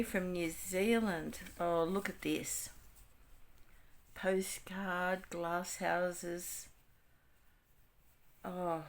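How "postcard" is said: ordinary talk, nothing marked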